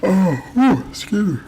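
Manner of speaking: words stretched by a big yawn. Real, not acted. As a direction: yawn right through the words. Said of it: "I don't know why I'm yawning so heavy".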